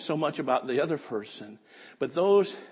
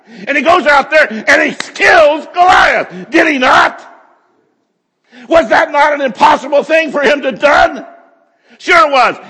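high, loud preacher shouting; preaching at full force; 13 semitones higher, 20 dB louder than usual. Acted. Preacher shouting David's defiance to the congregation. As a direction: thunder the point to the congregation.

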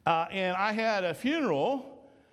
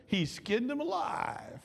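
higher, slow, wondering repeat; plain repeat; 7 semitones higher, slower than usual. Shown as a demonstration. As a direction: say it again higher and slow, savoring it. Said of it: "does that sound good?".

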